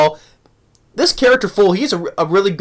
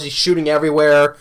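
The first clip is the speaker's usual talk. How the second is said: usual speaking voice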